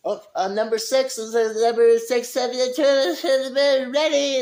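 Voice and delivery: high, loud fast-food order call. Retold or imitated. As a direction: sing out the number across the restaurant. Calling out an order number.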